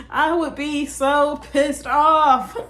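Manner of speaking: amused disbelief, laughing. Real, not acted. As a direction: say it through a laugh. Imagining a second no-show.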